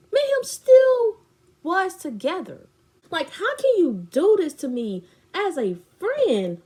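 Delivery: high, loud re-enacted protest; outraged confrontation. She accuses her fake friend, retold outrage. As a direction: protest the betrayal at full voice.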